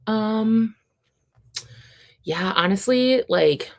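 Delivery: normal talking voice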